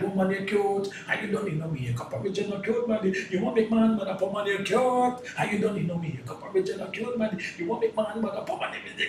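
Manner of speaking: light Jamaican patois chant; Jamaican style. Shown as a demonstration. Artist singing his reggae verse in Jamaican style. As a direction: ride the beat in a gentle Jamaican lilt. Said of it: "Jamaican style".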